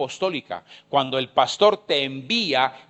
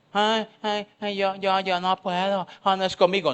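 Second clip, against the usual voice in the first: mock-whiny protest, higher and softer; lightly voiced fretful worker; whiny refusal; 3 semitones higher, 4 dB quieter than usual. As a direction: whimper out the excuse like a scared employee. Mock Lucía refusing the task.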